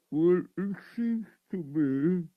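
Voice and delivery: normal talking voice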